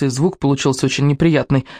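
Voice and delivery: ordinary delivery, nothing marked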